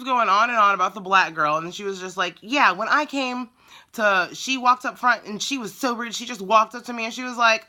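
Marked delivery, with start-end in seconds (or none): 2.4-7.7 mocking an indignant caller, higher pitched; retold customer complaint, pitched well up; complaining customer. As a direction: imitate a woman airing her grievance.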